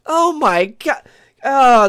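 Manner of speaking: normal talking voice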